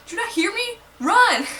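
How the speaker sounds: high, loud mock shout; hammed-up yell at a kid who won't run; a rescuer shouting at a stubborn kid, high and loud; exasperated yell. Voicing exasperated hero yell.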